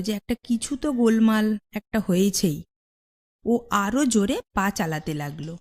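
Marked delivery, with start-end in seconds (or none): none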